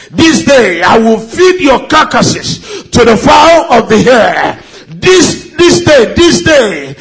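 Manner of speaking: building into a defiant battle cry; mock biblical prophet. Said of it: "David taunting Goliath".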